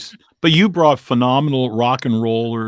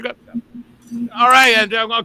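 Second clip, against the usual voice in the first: high, loud hammed-up radio DJ; imitating the DJ, loud and high; mock DJ voice; 9 semitones higher, 5 dB louder than usual. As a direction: rattle it off like an off-mic jock. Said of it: "He goes".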